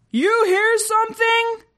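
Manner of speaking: very high, slow creature voice; puzzled alien. A narrator's reading. Crew member questioning a noise, puzzled. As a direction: ask it high and drawn out.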